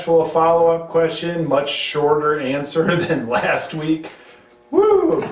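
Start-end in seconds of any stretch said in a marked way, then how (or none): 4.7-5.2 high whoop of joy; excited whoop. Real, not acted. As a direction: let out a happy cheer. Cheer after a short answer.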